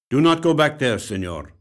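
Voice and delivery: a mild stern warning from the detective. A narrator's reading. Detective warning the visitor, firm and loud.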